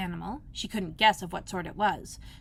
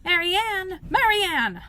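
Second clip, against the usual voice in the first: high, louder call across the house; 10 semitones higher, 6 dB louder, much slower than usual. A narrator's reading. Rabbit calling the maid from outside, loud call. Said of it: "heard a voice outside".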